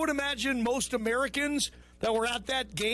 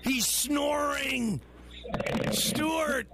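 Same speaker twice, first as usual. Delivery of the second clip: incredulous; 3 semitones higher, much slower than usual. Real, not acted. Amazed that the caller snores.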